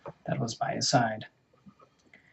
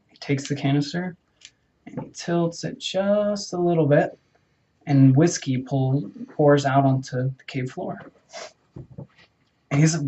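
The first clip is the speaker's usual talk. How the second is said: plain reading voice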